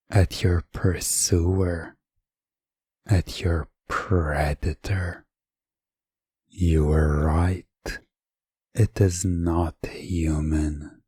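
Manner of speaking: ordinary delivery, nothing marked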